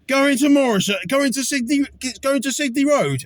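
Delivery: high, loud blurted command; startled himself yelling the road name; loud shout. Retold or imitated. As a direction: shout it out without meaning to. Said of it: "and I shouted it really loud".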